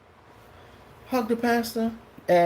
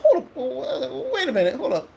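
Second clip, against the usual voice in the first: startled; 9 semitones higher than usual. Retold or imitated. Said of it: "I was like".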